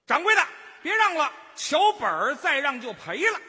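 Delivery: loud, high vendor's shout; high-pitched call. Shown as a demonstration. Helper practices calling to the shopkeeper, high loud cry. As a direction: push the pitch up and holler to the boss. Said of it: "再高一点点".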